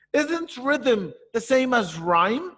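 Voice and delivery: high, slow questioning pupil voice; student asking. Acted. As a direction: ask slowly, rising high at the end. Teacher voices a puzzled student.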